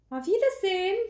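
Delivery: high, loud farewell; cheery German goodbye. Real, not acted. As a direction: sing out the goodbye.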